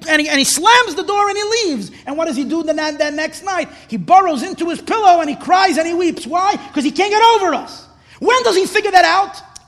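high, urgent sermon storytelling; impassioned preaching. Real, not acted. Preaches at full force.

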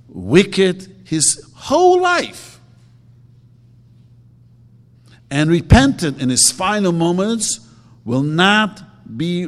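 loud, slow preaching of the verse; preaching the text. Acted. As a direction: proclaim it to the room. Reads the Rambam aloud.

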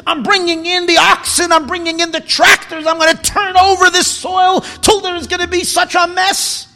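loud, high, furious rant at the field; hammed-up farmer's tirade, shouting; angry farmer. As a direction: pretend farmer yelling at his field. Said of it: "Does a farmer get angry at his field".

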